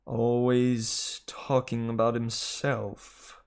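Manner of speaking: very slow, grumbling snake; slow sulky male snake voice; George the snake. Acted. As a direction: sulk it out slowly. George complaining.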